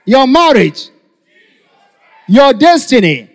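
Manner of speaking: forceful rising sermon questions; impassioned preaching. Acted. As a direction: drive each question at the congregation.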